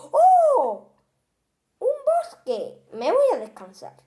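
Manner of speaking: high, loud gasp of discovery; light up at the sight; delighted surprise. Acted. Monster finds a park and cries out, high and loud.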